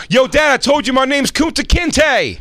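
high mock protest; hammed-up indignant kid; rebellious son. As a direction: whine back at dad with put-on swagger. Acted son protesting to father.

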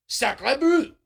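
high, loud, hammed-up French "Sacre bleu"; a tourist's clumsy French "Sacre bleu" with a rising end; bad French accent, mocking. Shown as a demonstration. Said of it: "Very bad pronunciation".

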